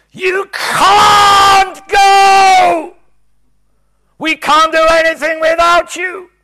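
very high, very loud, slow crying shout; desperate scream. Retold or imitated. Preacher reliving screaming at Jesus, desperate scream. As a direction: beg at full volume. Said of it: "I screamed at him".

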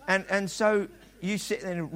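ordinary talk

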